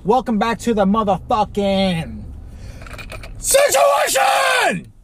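drawn-out hyped shout on situation; show intro shout. Acted. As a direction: belt it like a show host opening. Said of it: "that hurt my throat".

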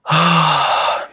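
sudden loud groan of frustration; loud exasperated groan; loud frustrated groan. Real, not acted. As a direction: let out a loud fed-up groan.

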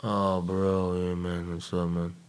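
lightly imitated druggy brother; a hint of stoned, out-of-it greeting; brother's stoned talk. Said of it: "this is how he talked".

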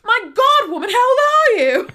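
loud high squeal in a hammed-up British accent; mock British accent. Andy to Karen, amazed, in loud mock British accent. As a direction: shriek it in a bad British accent. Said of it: "I can't stop with this accent".

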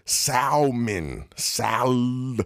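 SALMON high, with a heavy sounded L; over-pronounce the L in SALMON, drawing out "sal-"; mocking emphatic L. Mocking how bougie people say salmon, L hit hard in SALMON. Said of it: "they say that shit with emphasis".

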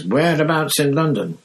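high, lively Cockney bus conductor; bright, high cheeky lad; Stan's chirpy voice. Acted. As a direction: chirp it out like a cheery young conductor. Stan voiced in audiobook.

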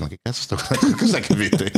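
high, laughing puzzled question; laughing disbelief. Retold or imitated. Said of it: "io ho i due gatti che ogni tanto dici".

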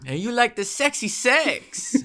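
high, mock Middle Eastern accent. Retelling the scammer's question, in a high mock Middle Eastern accent. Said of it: "a Middle Eastern accent".

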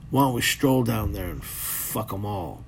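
lazy knowing punchline, taken slowly; wise old bull. Retold or imitated. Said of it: "the grandpa cow goes".

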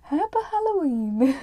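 "Halloween" after a mangled "Happy", high and loud; mock the typo "Happa" in a high, loud voice; misspelling read literally. That was H A P P A.

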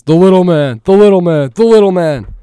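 loud, high announcer call. Retold or imitated. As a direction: mimic the game announcer. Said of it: "the announcer every single time is".

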